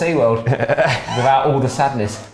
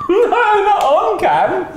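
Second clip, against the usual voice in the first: squeaky mock protest; 4 dB louder, much slower than usual. Joking refusal about the camera.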